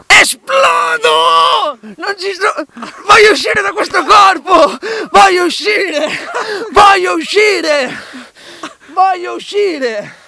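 high desperate shouting. Acted. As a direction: scream to break free. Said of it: "Ti senti esplodere".

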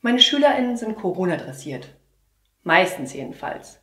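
ordinary delivery

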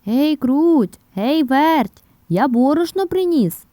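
high, loud rooster summons; calling out. A narrator's reading. Rooster back home with flour, calling the mice loudly. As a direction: holler each name, then the news. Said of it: "знов кличе мишенят".

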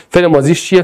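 ordinary talk

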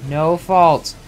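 ordinary talk

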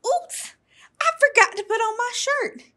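high embarrassed little frog; startled oops. A narrator's reading. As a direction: caught out again, squeak it. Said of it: "Froggy caught without shirt".

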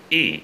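Y as a long "ee", lips pulled wide; long smiling i. Shown as a demonstration. Teacher demonstrates mouth position for learners, long smiling i on Y. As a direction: hold Y as a tense "ee" through a smile. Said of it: "cười sang hai bên".

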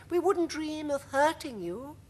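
very high, gentle ladybird voice; sweetly kind high-pitched creature; kindly ladybird. A narrator's reading. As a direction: reassure kindly in a tiny high voice. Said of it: "the ladybird said kindly".

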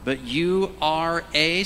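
slow rising lead-in, stress on "you"; preacher's prompt. Shown as a demonstration. Pastor cueing the congregation, slow rising prompt. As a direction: draw out "But you are a" for the crowd to finish. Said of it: "say the next two words".

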